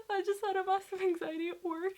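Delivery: high, strained, half in tears; replaying the shaky call to her boyfriend; teary retelling of the panic attack; retold teary voice. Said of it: "I couldn't even speak properly".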